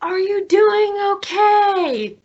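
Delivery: high, drawn-out shout; yelling over wind. Acted. Rider checking on Cassie through the wind, yelling. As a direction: call loudly across the wind. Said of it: "yell back over the wind".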